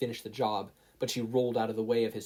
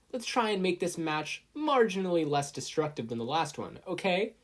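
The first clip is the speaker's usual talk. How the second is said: referee voice; 7 semitones higher than usual. A narrator's reading. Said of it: "Midnight advised".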